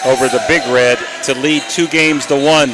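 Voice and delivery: excited game-winning call. Real, not acted. Calls the game-winning point.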